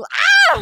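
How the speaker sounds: sudden high shriek of fright; scared yelp. Pretend. Player yelping at the mist man, high shriek. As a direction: yelp in mock terror at the figure.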